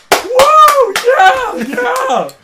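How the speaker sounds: high, full-voiced whooping shout; mock cheering. Imitating a cheer at Sulu killing the guy, high mock shout. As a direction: whoop and yell like a wild fan. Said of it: "he's like".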